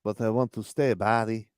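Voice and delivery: a hint of the player's foreign accent; imitated Jovic accent. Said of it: "And then the accent, too, when he's like".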